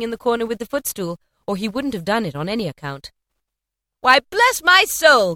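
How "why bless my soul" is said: high, loud, slow exclamation; big astonished cry; cried in surprise. A narrator's reading. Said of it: "cried Fred".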